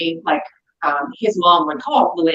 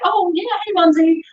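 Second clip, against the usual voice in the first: cheery greeting; 11 semitones higher than usual. Retold or imitated. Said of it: "that was like".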